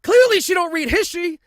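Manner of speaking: loud, high-pitched incredulous rant; exasperated outburst. Real, not acted. Host rants about the Volkswagen purchase, loud exasperated outburst.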